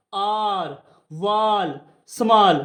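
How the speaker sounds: long open aa in "आर, वाल, स्मॉल"; long aa sound. Shown as a demonstration. Teacher reading examples of the big आ, long aa in "आर, वाल, स्मॉल". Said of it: "बड़े आ की sound निकाल रहा है".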